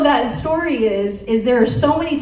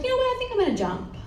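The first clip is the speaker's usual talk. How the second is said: lightly voiced small kid, higher and soft; 11 semitones higher, 6 dB quieter, much faster than usual. A hint of the kid in the video, talking to himself. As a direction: play the little kid deciding to jump. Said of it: "was like".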